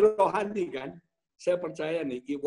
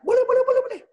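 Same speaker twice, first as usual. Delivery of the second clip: very high, loud, hammed-up eagerness; eager acceptance; 7 dB louder, much faster than usual. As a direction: jump at the offer in a high, loud burst. Eagerly accepting a business offer, retold. (pura-pura antusias sekali)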